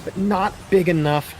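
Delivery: fast, high excited call. Real, not acted. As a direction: rattle it off at speed.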